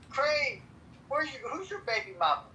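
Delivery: ordinary delivery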